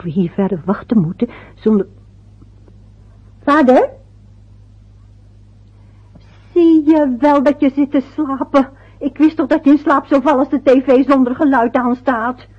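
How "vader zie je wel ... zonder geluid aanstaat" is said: louder, higher reproach to a dozing man; scolding father awake. Acted. Wife wakes her dozing husband, louder scolding reproach. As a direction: call him awake and scold him.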